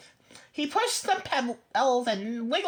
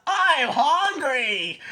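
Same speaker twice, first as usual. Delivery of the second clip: slow, louder hungry goat; 5 dB louder, much slower than usual. A narrator's reading. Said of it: "what he thought".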